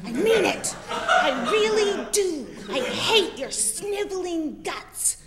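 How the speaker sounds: mock scream. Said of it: "Jay Diamond screaming at me".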